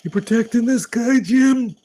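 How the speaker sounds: squeaky mock policeman; cop impression. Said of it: "I could do his voice like this".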